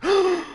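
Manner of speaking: loud high gasp of surprise; sharp surprised gasp. Real, not acted. Surprised there is a review.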